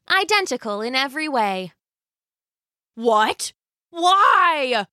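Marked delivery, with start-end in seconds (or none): 2.9-4.9 sputtering. Acted. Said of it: "sputtered Mister Hacktack".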